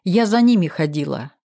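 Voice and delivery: ordinary delivery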